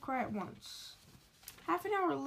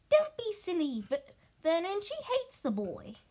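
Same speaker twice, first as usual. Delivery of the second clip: higher, louder dismissive wife; higher, sharp brush-off; scolding aunt; 6 semitones higher, 4 dB louder than usual. Acted. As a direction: brush off the husband's idea. Petunia scolds Vernon.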